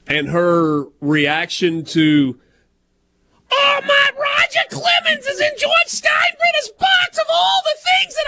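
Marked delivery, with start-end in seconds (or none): 3.5-8.2 very high, shrieking radio analyst; Susan Waldman impression. Co-host mimics radio analyst's reaction to a surprise return, shrieking impression. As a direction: shriek it in astonished, hammed-up disbelief. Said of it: "when I impersonate Susan Waldman".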